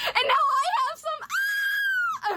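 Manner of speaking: very high shriek of delight; excited scream. Real, not acted. Collector finally getting foreign book editions, screaming excitedly. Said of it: "I screamed".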